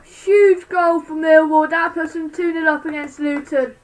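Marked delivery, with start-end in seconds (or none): none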